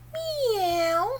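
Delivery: high, drawn-out kitty meow; high meow sliding downward; cat meow. Acted. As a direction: mew like a little cat. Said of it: "Kitty cat".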